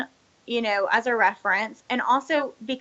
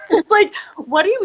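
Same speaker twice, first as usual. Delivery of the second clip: bursts out laughing, pitch jumps high; amused disbelief; 9 semitones higher, 7 dB louder than usual. Real, not acted. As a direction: laugh through the words in disbelief. Laughing at pointless hustle.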